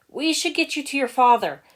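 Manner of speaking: loud, high call into the hallway. A narrator's reading. Henchman calling to the boss's son, loud and high. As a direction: call out to find the kid.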